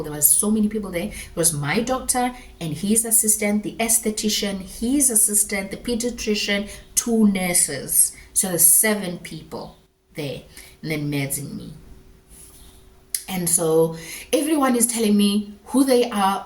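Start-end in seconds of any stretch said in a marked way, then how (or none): none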